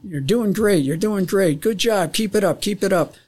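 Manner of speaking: high, retold cheering-on; relayed encouragement. Controller relaying ground troops' praise to the pilots, excited retold encouragement. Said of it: "then he's relaying".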